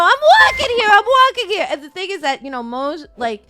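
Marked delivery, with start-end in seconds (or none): 0.0-1.7 loud, high mock New Yorker shouting. American podcaster mocking a New Yorker, loud and high. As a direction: bark it like a pushy New Yorker on the sidewalk. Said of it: "a common stereotype of New Yorkers where they're like".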